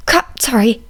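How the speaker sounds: ordinary delivery, nothing marked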